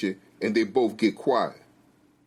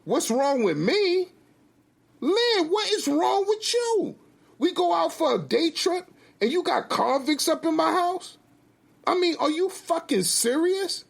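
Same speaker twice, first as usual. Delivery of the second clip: very high-pitched, outraged husband; indignant rant, voice pitched way up; angry retort. Acted. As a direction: throw the question back, incensed. Furious husband turns question back.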